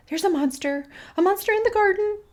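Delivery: high, hurried, scared cat voice; frightened cat. A narrator's reading. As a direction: warn urgently in a high little voice. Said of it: "the cat whispered".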